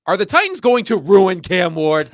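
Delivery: loud, high mock-dramatic question. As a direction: ask it like a big dramatic headline.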